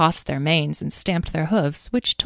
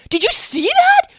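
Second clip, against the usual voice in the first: far higher squeal, louder, near breaking; squeaky overexcited; 7 dB louder than usual. Acted. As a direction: squeal it even higher, beside yourself. Lyle thrilled, repeats question.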